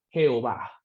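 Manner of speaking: "Jehová" with an h-like J, he-o-va; phonetic reading. Shown as a demonstration. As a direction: sound out "Jehová" as it is spoken, dropping low. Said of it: "Fonéticamente".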